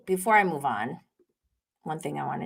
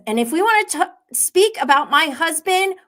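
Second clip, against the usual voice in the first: heated quote; 9 semitones higher, 5 dB louder than usual. Retold or imitated. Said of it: "Bronwyn says".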